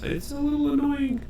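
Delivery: way up high in pitch; squeaky high voice. Acted.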